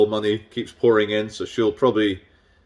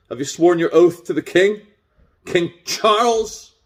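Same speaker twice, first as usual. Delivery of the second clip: mocking question; 6 semitones higher than usual. Real, not acted. Mocking officers' royal oath.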